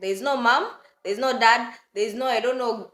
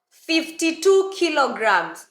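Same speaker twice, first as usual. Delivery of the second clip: slow and louder, hammering "Fifty-two"; exasperated stress on the weight; 7 semitones higher, 6 dB louder, slower than usual. Real, not acted. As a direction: punch "Fifty-two kilograms" with disbelief. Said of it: "emphatic repeat of the weight".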